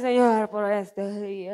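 slow, drowsy prayer sinking in pitch; mock sleepy prayer. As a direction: pretend to nod off while praying. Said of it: "que llegamos con sueño".

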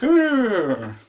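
high, loud mock whinny; imitated horse neigh. Showing Gandalf's horse on the hilltop, loud imitated neigh. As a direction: imitate a rearing horse's cry.

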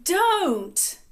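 high-pitched, cutting-in aunt's don't; a quick scold from a fussy aunt. A narrator's reading. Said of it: "Most of the aunt's remarks".

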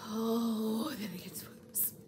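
hushed, breath-only aside; ominous whisper. Real, not acted. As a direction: drop to a whisper to tease what comes next. Repeats teaser line, hushed.

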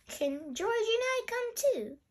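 put-on eager child. Peppa asks to come along.

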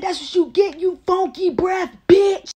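high, heated taunting yell; angry taunt. Retold or imitated. Woman to the man she sprayed, angry taunt. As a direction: throw it back at him, high-pitched and furious. Retold fight after pepper spray.

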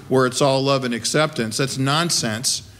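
normal talking voice

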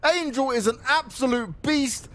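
ordinary delivery

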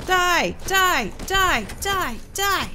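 high yelled chant of die; battle cry. Real, not acted. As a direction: shout with each strike. Attacking repeatedly.

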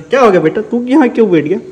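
loud high call to the pet; calling the cat. Real, not acted. (बिल्ली को ज़ोर से पुकारना)

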